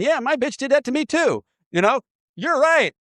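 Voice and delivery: high mocking voice of agreeing members; sneering take on meeting chatter; mocking cosigners. Members cosigning each other's complaints, high mocking voice.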